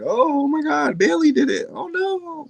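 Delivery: high-pitched shocked squeal; shocked fan. Retold or imitated. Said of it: "and it was like".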